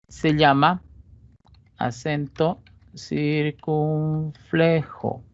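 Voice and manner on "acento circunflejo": "acento circunflejo" stretched out very slowly; drawn out, syllable by syllable, for the class; slowly dictating "acento circunflejo"; slow and deliberate. Shown as a demonstration. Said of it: "teacher spells out term".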